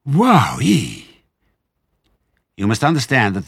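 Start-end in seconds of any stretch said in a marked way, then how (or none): 0.0-1.2 high, loud breathy wow; swooping astonished exclamation; gasped amazement. A narrator's reading. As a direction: let it burst out after a fiery swig. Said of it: "he gasped".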